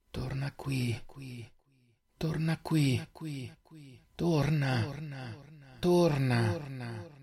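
soft, drawn-out haunting call; echoing call back. A narrator's reading. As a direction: call her back like a distant voice in her head. Echoing voice calls her back. (richiamo sommesso e lento, con eco)

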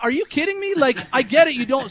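mock outrage, voice cracking up high; incredulous outburst. Host retelling yelling at the dad who threw a bun, incredulous.

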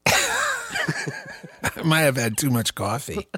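slow, high, laughed-out admission; laughing. Real, not acted. Blaming coffee for the giggle fit, laughing. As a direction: say it through helpless laughter.

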